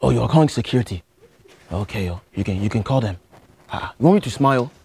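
put-on lightly held Nigerian accent, earnest student; a Nigerian student pleading with the teacher. To a geography teacher, a mild Nigerian accent. Persona reacts to security call.

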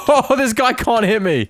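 laughing. Real, not acted. Laughing while winning a fight.

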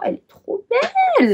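high squealing gush of delight; excited squeal. Real, not acted. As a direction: squeal it like you love it. Gushing over a nail polish shade.

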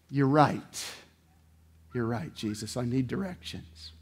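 low, soft confession; bow your head and admit it; humble surrender. Acted.

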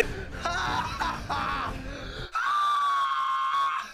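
long, very high squealing laugh; helpless high-pitched cackle; high laughing shriek. Real, not acted. Reacts to car in wrong lane.

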